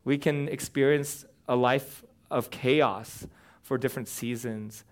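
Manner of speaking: ordinary talk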